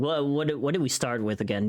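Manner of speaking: normal talking voice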